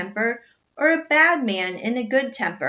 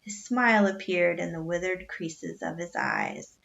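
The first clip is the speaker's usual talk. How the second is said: ordinary delivery, nothing marked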